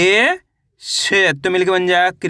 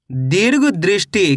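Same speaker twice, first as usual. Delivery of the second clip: slow careful reading; slower than usual. Shown as a demonstration. Teacher showing children how to read दीर्घ दृष्टि, slow and careful. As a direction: read दीर्घ दृष्टि slowly, each part drawn out. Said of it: "कैसे पढ़ेंगे?".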